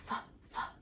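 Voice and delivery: breathy hissed f sounds. Shown as a demonstration. Teacher modelling the f sound for little kids, hissed. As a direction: push out a soft f hiss. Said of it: "get your F sound ready".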